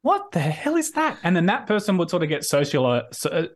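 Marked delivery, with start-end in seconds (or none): 0.0-1.2 high-pitched baffled exclamation; mock disbelief, pitch way up; incredulous reaction. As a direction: imitate a whole group taken aback. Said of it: "all of us would kinda go like".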